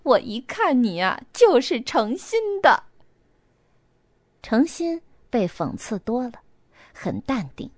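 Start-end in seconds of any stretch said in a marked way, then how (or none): 0.0-2.8 高调放肆的浪笑，拖长说完; 像个夸张的化妆师那样浪笑着开口. A narrator's reading. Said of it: "Tommy一阵的浪笑". (放肆浪笑)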